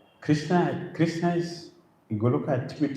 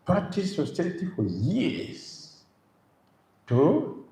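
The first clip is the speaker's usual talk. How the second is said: normal talking voice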